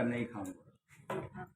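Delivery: very soft, low, drawn-out reply; meek mock refusal. Storyteller as the simpleton telling Hanuman no, meekly acted. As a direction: refuse under your breath, slowly.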